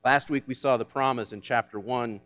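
normal talking voice

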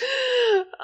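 sharp theatrical gasp; a hammed-up wife's gasp; shocked gasp. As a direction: gasp in horror. [name] shocked on phone.

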